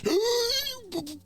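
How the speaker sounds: high choked gasp instead of a scream; startled by a chipmunk, a high gasp; frightened gasp. Retold or imitated. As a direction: gasp like the breath got knocked out. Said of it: "I couldn't scream".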